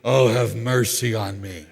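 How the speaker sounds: slow, low mock-pleading prayer; humbled plea. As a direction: drag it out low, head down. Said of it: "with my head down thinking".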